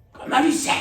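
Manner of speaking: high, loud snapped order; panicked command to stay still; sharp hush. Retold or imitated. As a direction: shut him up fast before trouble. Man hushing the cleaner quickly.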